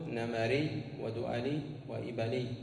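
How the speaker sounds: نمري ودؤلي وإبلي as "namarī, du'alī, ibalī"; fatha in each middle. Shown as a demonstration. Teacher reading the correct forms, نمري ودؤلي وإبلي all with middle a. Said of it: "بفتح العين فيهن".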